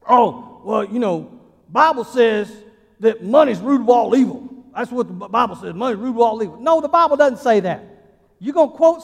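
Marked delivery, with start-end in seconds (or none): 0.1-5.7 loud, know-it-all put-on voice; mock misquoting churchgoer. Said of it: "But I get this one quoted wrong". 5.8-6.6 "Money's root of all evil" blurted loud and smug; mock misquote. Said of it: "I get this one quoted wrong".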